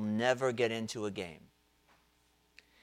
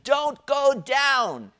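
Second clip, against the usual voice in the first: much higher, louder, slow drawn-out yell; team yelling; 14 semitones higher, 9 dB louder, much slower than usual. Retold or imitated. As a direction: bellow it like a whole bench shouting. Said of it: "the whole team starts yelling at him".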